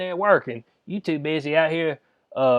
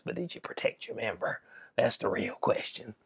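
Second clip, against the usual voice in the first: conspiratorial whisper; 6 semitones lower, 8 dB quieter than usual. Real, not acted. Cheeky whispered jab at Doctor Mike, softer. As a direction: drop to a sly whisper on the question.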